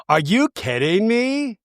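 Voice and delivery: high, drawn-out incredulous question; mock disbelief. Acting out the offended friend's reply, mock disbelief. Retold outrage at comparison.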